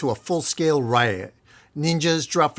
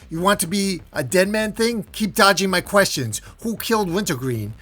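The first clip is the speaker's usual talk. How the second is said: higher, louder angry demand; snapping back; 6 semitones higher, 3 dB louder than usual. Acted. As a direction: press hard for an answer, voice raised. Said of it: "Slade snaps out of it".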